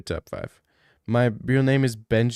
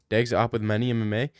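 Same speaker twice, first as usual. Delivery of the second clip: ordinary talk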